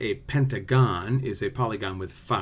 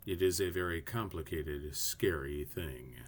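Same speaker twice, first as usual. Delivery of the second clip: playfully ominous, sinking low and quiet; mock-ominous warning; 6 semitones lower, 11 dB quieter than usual. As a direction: pretend it's terribly scary, dragging out the end. Mock warning, then admits kidding.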